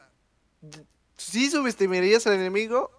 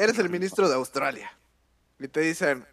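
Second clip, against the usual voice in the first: usual speaking voice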